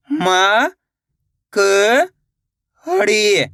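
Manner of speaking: isolated, held sounds with long pauses; teacher slowly spelling out by sound; syllable by syllable. Shown as a demonstration. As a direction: sound out each syllable separately for learners. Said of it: "एक अक्षर का उच्चारण करके".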